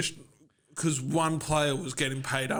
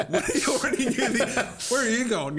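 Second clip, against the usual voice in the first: laughing through the words, pitch climbing; 6 semitones higher than usual. Real, not acted. Co-host mocking a cop's questions, laughing. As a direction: crack up mid-riff.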